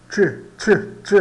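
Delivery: "ch" as a short TCH burst, three times; CH sound demo. Shown as a demonstration. Teacher showing the Spanish C H sound, CH demo. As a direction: isolate "ch" as in chip, repeated crisply. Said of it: "a pronúncia do C H".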